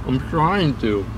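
slow mumble around caramel; slow, chewing through the words; chewing sticky toffee. Real, not acted. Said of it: "caramel in my mouth".

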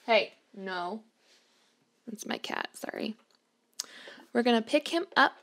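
normal talking voice

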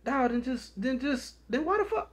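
high, soft exasperated question; baffled, pushing back. Retold or imitated. Narrator baffled, asking why he stays, high pitched. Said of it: "Then I be like".

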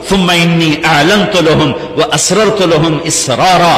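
usual speaking voice

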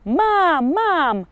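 high, loud triumphant cry; loud high yelp of triumph; excited cry. A narrator's reading. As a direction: shout the find from afar. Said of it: "okrzyk Reksia".

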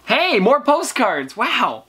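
high, drawn-out happy exclamation; delighted surprise. Real, not acted. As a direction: greet the find with a long, rising cheer. Delighted at opening more postcards.

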